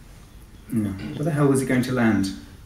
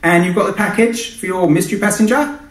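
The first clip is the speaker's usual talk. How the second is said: much higher and louder brash client shouting; shouty client voice; 8 semitones higher, 5 dB louder than usual. Acted. Client Sinatra shouts questions at the pilot, brash and pushy. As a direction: yell through the intercom, pushy. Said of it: "A shouter too".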